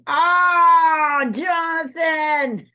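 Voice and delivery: loud, stretched-out cry of a name; teacher thrilled by a student's painting; delighted shout. Real, not acted. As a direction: call his name out in delight.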